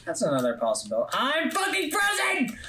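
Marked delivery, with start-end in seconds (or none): 1.1-2.6 screaming in frustration; high yelling panic; panicked yell. Real, not acted. Player trapped in game, yelling.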